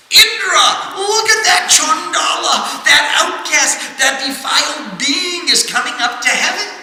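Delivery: alarmed call. Acted. Gods at heaven's door cry out to Indra, shouting. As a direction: raise the alarm at the gate.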